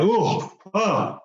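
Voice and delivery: dazed groan. Retold or imitated. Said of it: "I'm like".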